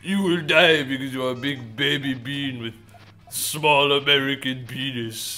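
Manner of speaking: a hammed-up, not very good Japanese accent; mock a Japanese rival with a clumsy Japanese accent; bad Japanese accent. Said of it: "my Japanese accent ... is not that good".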